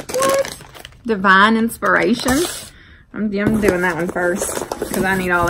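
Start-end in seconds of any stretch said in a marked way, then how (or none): 0.1-0.6 sudden squeaky high what; genuinely startled by what's inside; surprised. Real, not acted. Vlogger finds an odd coffee name, surprised.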